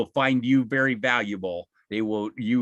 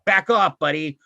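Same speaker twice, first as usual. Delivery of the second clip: firm boundary; 3 semitones higher, 6 dB louder than usual. Shown as a demonstration. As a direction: snap a boundary at someone pushing you. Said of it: "I go".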